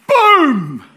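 high, loud sudden boom; shouted boom. Acted. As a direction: drop it like a bomb. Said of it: "this way of saying boom".

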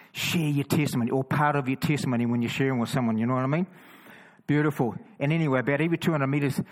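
normal talking voice

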